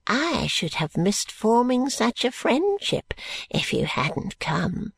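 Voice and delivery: put-on slow, drawn-out old man's gushing; butter him up in a swooping old voice; stretched, sing-song flattery from an old schemer; wheedling old man, effusively friendly.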